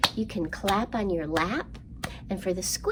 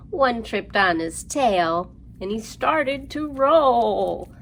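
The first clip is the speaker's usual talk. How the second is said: slow lively rhyme, louder on the roll and growl; rhyme for kids; 3 semitones higher, 6 dB louder, slower than usual. Acted. Librarian reciting counting rhyme to children, lively. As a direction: act out the lions tumbling.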